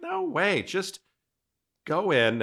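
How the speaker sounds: high, bright burst of encouragement; excited cheer. Retold or imitated. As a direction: pitch way up and egg them on. Imitating himself cheering a friend on.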